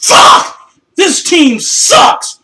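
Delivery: explode, full-throated yell of rage; enraged fan screaming at his losing team; furious shout. Real, not acted. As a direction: bellow it at the screen, fed up. Fan's furious outburst at his team.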